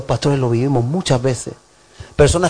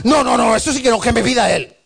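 higher, indignant refusal; mock indignant refusal; 8 semitones higher than usual. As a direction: refuse like a proud church member. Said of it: "le tienes que pedir perdón".

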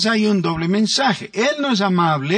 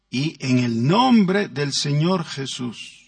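ordinary talk

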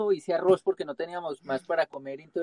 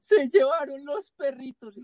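higher, slow tearful voice, mocking his wife; husband mimics his crying wife, higher and slow; imitating crying; 8 semitones higher, slower than usual. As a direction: lightly imitate a tearful lament.

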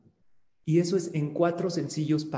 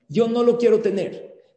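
mock loud protest; 5 semitones higher, 6 dB louder than usual. Shown as a demonstration. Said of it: "Imagínense".

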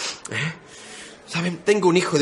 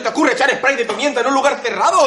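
pained laughing rant; 8 semitones higher than usual. Acted. Coughing from pepper spray.

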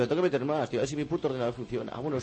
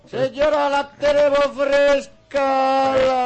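much higher, louder, slow sing-song call; market hawker cry; 10 semitones higher, 10 dB louder, much slower than usual. Pretend. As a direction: sell fresh clams to a lady at the stall. Pregón de vendedor a señora. (pregonero de mercado, agudo y a gritos)

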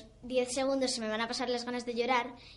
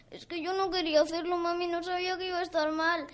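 high pleading excuse to mum; imitated childish self-excusing plea; innocent little sister; 6 semitones higher than usual. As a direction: act the blameless youngest child. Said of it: "haces algo mal y dices".

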